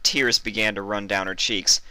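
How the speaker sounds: plain reading voice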